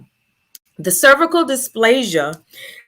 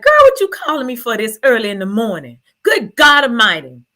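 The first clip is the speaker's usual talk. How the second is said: loud, exasperated friend woken too early; indignant outburst at an early phone call; grumpy morning friend; 3 semitones higher, 5 dB louder, faster than usual. Retold or imitated. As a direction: rattle it off, annoyed at being called so early. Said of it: "my best friend is not a morning person".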